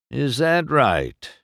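slow, skeptical question with a falling end; unimpressed. A narrator's reading. Doc answers the boasting race car, unimpressed. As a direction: draw it out, not buying it. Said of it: "clearly unimpressed".

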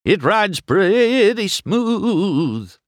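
slow, high, bumpy drawn-out words; jostled about. A narrator's reading. Mater praises the bumpy road while jostled. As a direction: stretch each word as the road shakes you. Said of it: "as he was jostled back and forth".

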